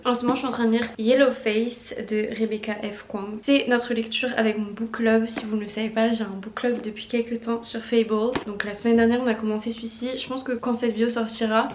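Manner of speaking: ordinary delivery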